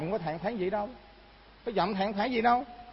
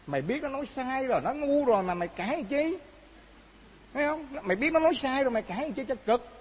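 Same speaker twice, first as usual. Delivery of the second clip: higher-pitched, exasperated telling-off; acted scolding; 6 semitones higher than usual. Judge scolds the beaten man.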